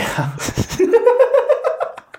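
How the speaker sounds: high-pitched real laugh. Real, not acted. Co-host teased about which photo to show, high laugh.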